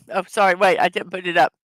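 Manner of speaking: ordinary talk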